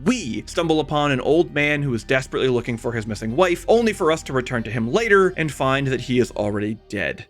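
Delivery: ordinary delivery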